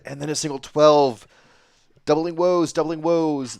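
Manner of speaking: loud, high, repeated lament; excited call. Real, not acted. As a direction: hammer the phrase like a catchphrase. Commentator on missed doubles.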